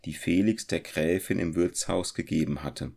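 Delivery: ordinary delivery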